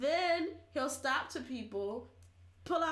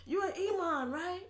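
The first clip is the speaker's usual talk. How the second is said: higher-pitched mock evangelist; insistent preacher impression, pitched up; imitated train preacher; 4 semitones higher than usual. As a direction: press a stranger as a preacher. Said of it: "he's like, [West African man on train]".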